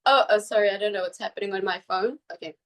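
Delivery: normal talking voice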